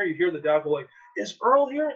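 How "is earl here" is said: imitating a dad calling through the house; much higher-pitched acted call. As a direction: call it out as an eager question. Dad calls out from another room.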